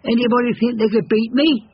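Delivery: high, cocky challenge; challenging hare voice. Acted. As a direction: dare anyone to race. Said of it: "said the hare".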